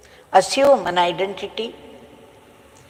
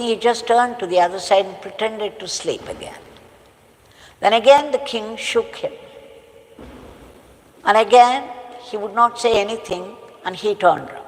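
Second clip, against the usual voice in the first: ordinary delivery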